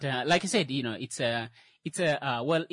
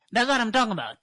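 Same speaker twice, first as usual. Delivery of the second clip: excited; 9 semitones higher, 7 dB louder, faster than usual. Real, not acted. Excited reaction to own joke.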